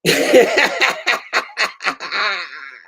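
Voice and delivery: high, loud burst of laughter; real laughing fit. Real, not acted. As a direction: crack up at your own joke. Laughs at own bit.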